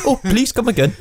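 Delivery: high, comic Indian shopkeeper accent. Shown as a demonstration. Co-host coaches the reader, high Indian accent. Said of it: "bring some Punjab back into that".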